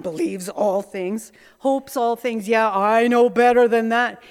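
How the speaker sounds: mocking the verse, then a loud sneering yeah; scornful mockery. Preacher voicing her scornful mind mocking the verse, sneering. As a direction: sneer at the words like a know-it-all. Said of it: "Hear that kind of an attitude?".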